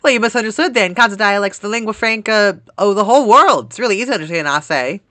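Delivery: put-on high, loud country accent; hammed-up country bluster. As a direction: play an insistent country character. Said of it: "every time I do a country accent".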